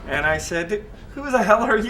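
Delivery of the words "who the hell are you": higher and louder still, laughing, thrown straight back; retold comeback. [name] firing the boss's question back at him, louder and laughing. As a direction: bounce it back even bigger, laughing.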